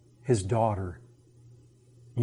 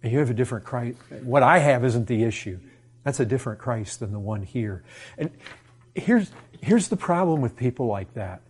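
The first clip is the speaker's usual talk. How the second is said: normal talking voice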